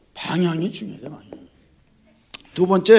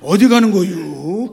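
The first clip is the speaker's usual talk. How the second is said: slow, laughing mock Jeolla accent; Jeolla dialect navigation voice; 3 dB louder, slower than usual. Preacher imitating the regional GPS voice, slow Jeolla accent. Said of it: "전라도 사투리가 나와".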